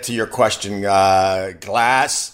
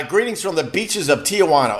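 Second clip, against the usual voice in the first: usual speaking voice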